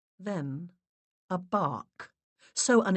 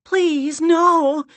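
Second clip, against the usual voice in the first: high, loud plea; loud cry; 12 semitones higher, 13 dB louder than usual. A narrator's reading. Larissa begs the spirits, high and loud. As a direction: cry out in alarm. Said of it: "Larissa cried".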